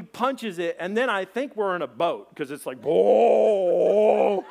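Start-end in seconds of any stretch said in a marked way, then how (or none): 2.8-4.4 big drawn-out whooping "whoa"; long loud whoa. Acted. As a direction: holler it as the truck starts floating. Truck plunges into the river.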